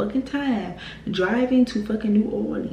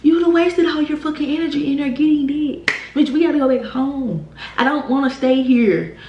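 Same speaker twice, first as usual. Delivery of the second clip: higher, louder heated telling-off; reliving the fury at her friend, loud and sharp; angry rant; 5 semitones higher, 4 dB louder than usual. Retold or imitated. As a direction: chew her out like you're fed up. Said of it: "I was mad because I'm like".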